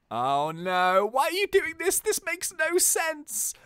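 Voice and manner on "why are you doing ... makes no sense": pleading detective. Acted. Detective confronts villain.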